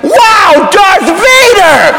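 put-on high, excited kid's cheer; high-pitched, drawn-out wonder; awestruck kid. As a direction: squeal it like a thrilled child. Imagined delighted kid seeing Vader.

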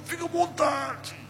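high, soft squeeze of a failing voice; imitated strained, pained near-voiceless reply. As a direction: barely get it out, acting badly hurt. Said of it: "A voz já não saía".

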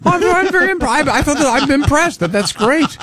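high, overdone amazement; mock impressed. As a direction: gush with fake awe. Mock-impressed reaction to letter.

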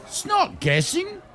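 high-pitched offended insistence; protest, offended and squeaky; squeaky indignant defence. Acted. Baz indignantly defends himself.

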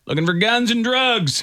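high-pitched, called-out quote; retold dad's answer. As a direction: imitate a brazen dad calling back. Voices dad's loud answer.

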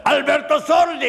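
high announcer's call; imitated ceremony announcer; shouted stage announcement. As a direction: call out the star's name. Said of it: "oni řvali".

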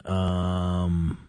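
ordinary delivery

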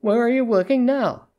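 high-pitched, pointed question. A narrator's reading. Pointed question, meant to be overheard.

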